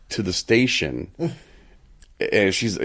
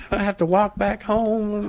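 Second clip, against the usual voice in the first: much higher, slow strange put-on voice; mimicking the strange weather lady; odd lady voice; 13 semitones higher, slower than usual. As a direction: play her dazed and pitiful. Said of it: "she's like".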